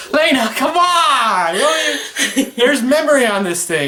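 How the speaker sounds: high, loud, laughing protest; exasperated but cracking up; laughing exasperation. Real, not acted. Pleading with giggling wife.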